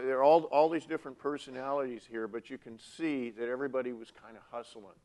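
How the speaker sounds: ordinary talk, nothing marked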